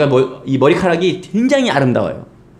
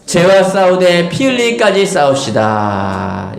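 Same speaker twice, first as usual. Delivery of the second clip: congregation-style proclamation; 4 semitones higher, slower than usual. Real, not acted. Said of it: "시작".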